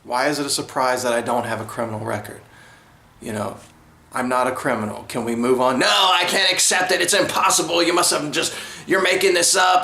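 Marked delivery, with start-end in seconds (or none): 5.8-9.8 higher, scoffing cop voice; mock disbelieving officer. Police officer rejecting his clean record, higher mocking disbelief. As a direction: play the officer who won't believe him.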